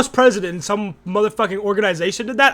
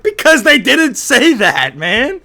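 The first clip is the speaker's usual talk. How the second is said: loud, drawn-out indignant rebuttal; indignant protest; 3 semitones higher, 6 dB louder, slower than usual. Real, not acted. Viewer arguing back at the debater, indignant. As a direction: push back loudly, stretching the words.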